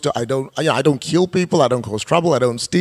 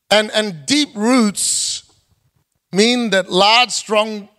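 preaching; 4 semitones higher than usual. Acted. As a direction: preach it with growing conviction. Preaching with rising force.

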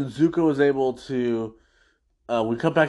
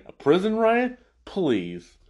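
mock incredulous; 8 semitones higher than usual. Chit Sang scoffing at the riot question, high then dismissive. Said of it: "And then Chit Sang".

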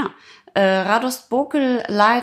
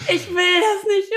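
weinerliches Kind; 12 semitones higher than usual. Retold or imitated. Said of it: "Und meine Mama so".

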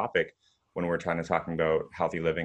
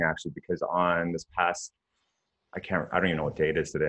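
normal talking voice

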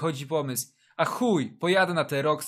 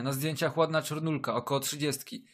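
usual speaking voice

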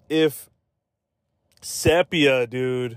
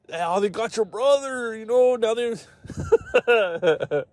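much higher hammed-up thug voice; mock gangster story; 8 semitones higher, slower than usual. Gang member explaining to brother, high mock thug voice. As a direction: tell it like a cartoon gangster caught out.

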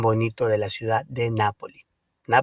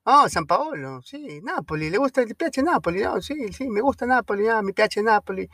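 much higher excited local voice; imitating the young Neapolitans; 10 semitones higher than usual. Young Napoli fans answer the lost tourist, high and eager. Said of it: "young locals answering".

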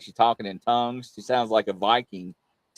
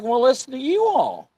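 high, teasing put-on voice; mocking comeback; 12 semitones higher than usual. Host retorts to guests who tease his accent, high mock voice. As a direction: toss it back in a silly high mock voice. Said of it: "I'm like".

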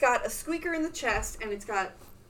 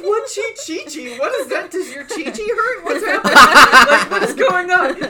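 confused husband; 6 semitones higher, 14 dB louder than usual. Retold or imitated. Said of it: "He's like".

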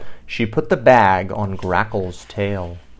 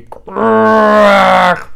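long, loud, high beast howl; groaned quack; 10 semitones higher, 11 dB louder than usual. A narrator's reading. Beast groaning at the sleeping bird. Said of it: "groaned Grackle".

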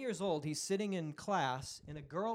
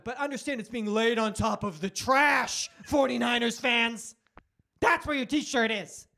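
building to a loud, slower taunt; defiant proclamation; 4 semitones higher, 6 dB louder than usual. Acted. Telling rival fans about the trashed T-shirt, loud defiance. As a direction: announce it to the whole crowd.

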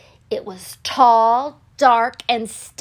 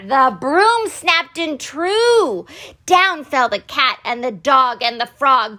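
ordinary delivery

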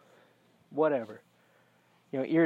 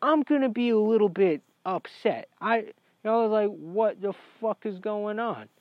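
higher-pitched, indignant retelling; affronted, at a raised pitch; upset disbelief; 6 semitones higher than usual. Retold or imitated. Patient reacting to freaked-out dentists, upset disbelief.

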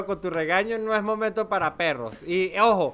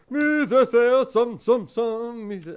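mock slow start; 2 semitones higher, much slower than usual. Mocking the host's slow start.

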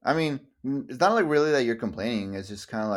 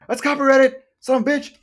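much higher and louder hammed-up shout; mock angry yell; 9 dB louder than usual. Pretend owner yelling at a napper, hammed-up shout. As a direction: bellow it like a cartoon villain.

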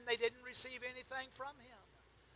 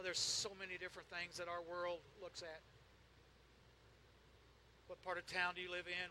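normal talking voice

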